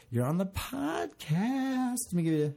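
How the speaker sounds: excited. Real, not acted. Excited uncle tells child on podcast.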